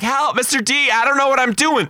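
put-on high whiny kid voices crying for help; panicky kids imitated. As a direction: squeal like lost five-year-olds. Kids begging teacher for help.

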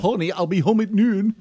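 put-on English accent drifting into Scottish; botched English accent. Telling his wife in a would-be English accent. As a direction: badly fake British, landing near Scottish. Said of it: "A little Scottish".

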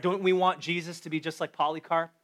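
ordinary talk

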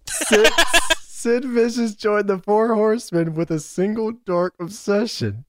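high, drawn-out dramatic read; dramatic card reading. Acted. Podcaster reading [name]'s card to co-hosts, slow and dramatic. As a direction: stretch it out like a portentous announcer, amused. Reads trading card aloud.

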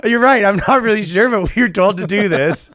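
high, loud sheepish bank recruiter; mock recruiter. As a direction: play the caught-out recruiter, laughing it off. Mocking bank recruiter reply.

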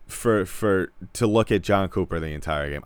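normal talking voice

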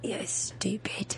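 slow whisper; whispered jab. Real, not acted. Friend whispers a jab back. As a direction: breathe it out.

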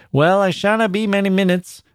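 kindly neighbour reassuring her at the gate; voiced miner. A narrator's reading. Miner's voice in the story.

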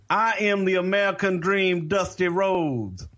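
high-pitched, indignant star announcing himself; Dusty Rhodes, incredulous. Retold or imitated. Star wrestler to a cop who asked, incredulous. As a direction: proclaim it incredulously, drawing out the name. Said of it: "he incredulously looks over at that cop and goes".

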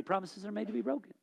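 tossed-off quote of a popular saying; mocking the saying. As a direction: rattle off the cliché dismissively. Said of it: "it's become a saying in our society".

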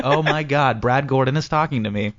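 ordinary talk, nothing marked

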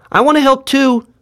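put-on very high, eager child's voice; Mulik calling out. A narrator's reading. Son volunteers for the picket line, calling out high. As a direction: pipe up high as a keen little boy. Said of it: "Mulik called out".

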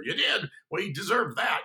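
loud, high, emphatic approval; retold lively reaction. Friend hearing he swore at the boss, emphatic reaction. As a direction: react with loud, surprised backing.

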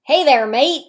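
loud, hammed-up British greeting; over-the-top fake British; bad British accent. Shown as a demonstration. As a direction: attempt a British accent, badly. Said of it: "Let's hear your best British accent".

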